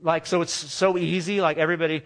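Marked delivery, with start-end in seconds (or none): none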